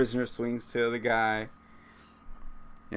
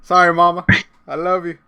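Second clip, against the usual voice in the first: higher and louder, mock sorry; playful apology; 6 semitones higher, 9 dB louder than usual. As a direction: call out sorry to mom.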